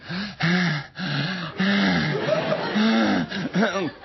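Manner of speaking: mock disco moan; orgasm gasp. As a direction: fake an over-the-top sensual gasp. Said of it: "this woman's breathing that went".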